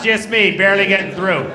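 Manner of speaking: ordinary talk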